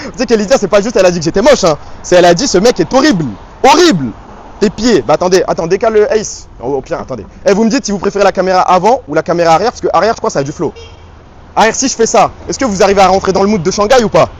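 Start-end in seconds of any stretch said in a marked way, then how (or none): 0.1-6.5 loud, higher-pitched projection; shouting outdoors. Real, not acted. Streamer rants to chat outside about being called ugly, shouting. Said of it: "je vais devoir commencer à crier". 7.5-14.3 loud, raised outdoor voice; shouting to viewers. Real, not acted. Streamer asks chat about camera angles in Shanghai, shouting. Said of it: "Crie pas".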